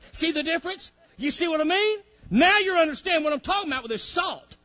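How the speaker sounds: loud, ringing pulpit preaching with high pitch; preaching at full force. Acted. Preacher rousing his congregation, loud full-force preaching. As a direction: preach it out to the whole church.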